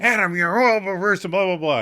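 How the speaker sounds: high, loud throwaway lament; hammed-up fishing for reassurance; mock self-pity. Host teases co-host with fished-for reassurance, loud mock lament. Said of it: "I do that to you".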